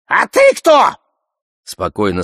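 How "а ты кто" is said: high-pitched loud squeal; Алёш. A narrator's reading. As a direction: shriek the question in outrage. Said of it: "Алёш визжит". (пронзительный визг)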